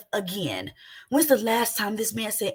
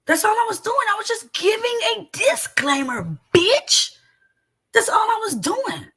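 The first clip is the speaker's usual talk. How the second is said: much higher, loud, exasperated; angry outburst; 9 semitones higher, 4 dB louder than usual. Real, not acted. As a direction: blow up in frustration.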